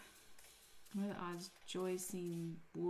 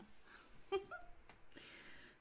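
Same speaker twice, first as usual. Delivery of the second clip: airy giggle up high; a high airy laugh; high breathy giggle; 13 semitones higher, 11 dB quieter than usual. Real, not acted. Laughing at a misread name, high breathy giggle.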